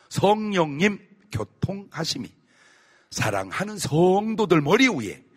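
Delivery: slow, solemn blessing; benediction. Acted. Pastor gives the closing blessing over the believers, slow and solemn. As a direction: bless the flock with slow earnestness.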